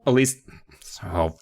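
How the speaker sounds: ordinary talk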